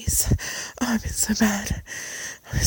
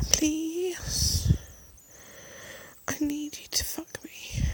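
pleading; 6 semitones higher, 4 dB quieter, much slower than usual. Acted. Repeated pleading.

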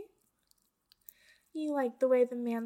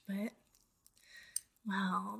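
ordinary talk, nothing marked

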